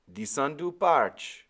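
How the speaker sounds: put-on high, loud island-accented old man; old Caribbean sailor. Old sailor answering the boy, Caribbean accent. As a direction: give Timothy his lilting Caribbean voice. Timothy speaks.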